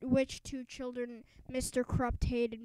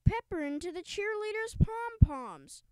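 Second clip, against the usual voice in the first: raised, higher outraged call; fan shouting; 5 semitones higher, 3 dB louder than usual. A narrator's reading. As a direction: shout the accusation to the crowd. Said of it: "shouted a fan".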